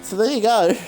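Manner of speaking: high, fast, breathless call; excited commentary. Real, not acted. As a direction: rattle it off at the finish line. Frantic commentary at the finish.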